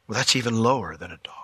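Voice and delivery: quick whispered aside. Real, not acted. Pastor to congregation, quick whispered aside. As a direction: murmur it under your breath. Aside after dead dog.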